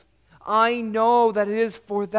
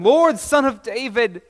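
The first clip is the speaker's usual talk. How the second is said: louder, higher plea to the Lord; crying out; 7 semitones higher, 9 dB louder than usual. A narrator's reading. Mother crying out to Jesus, loud plea. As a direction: call out desperately for mercy. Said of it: "the woman was still crying out to them".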